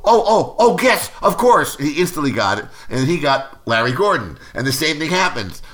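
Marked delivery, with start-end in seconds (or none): 0.0-1.8 high, loud dawning "oh"; sudden realization. Retold or imitated. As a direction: exclaim as the idea suddenly clicks. Reenacts Joel suddenly getting the idea.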